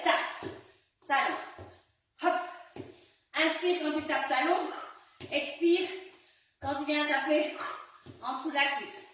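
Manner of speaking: pumped-up fitness coach. Acted. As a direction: push them through the burn. Coach pushing through the exercise.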